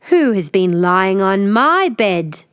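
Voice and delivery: slow, loud bear growl; growling father bear. Acted. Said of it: "Father Bear growled".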